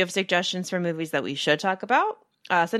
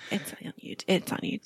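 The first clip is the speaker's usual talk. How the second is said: whispering; 7 dB quieter than usual. Pretend. Conspiratorial aside about illegal copy.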